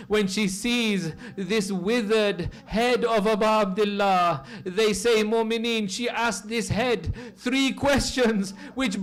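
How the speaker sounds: high, loud grieving sermon cry at full force; impassioned lament. Acted. Preacher crying out Sakina's grief to the mourners, loud and high. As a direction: pour the grief out over the mourners.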